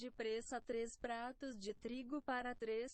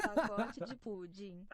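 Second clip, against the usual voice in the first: laughing; 7 dB louder than usual. Real, not acted.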